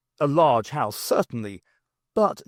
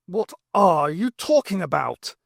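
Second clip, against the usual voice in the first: slow, louder, fed-up demand; a hint of a cross officer; exasperated; 5 semitones higher, 4 dB louder than usual. Brigadier losing patience with Jeremy, exasperated. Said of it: "quite exasperated".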